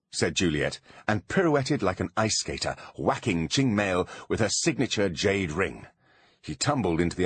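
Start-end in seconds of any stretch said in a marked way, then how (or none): none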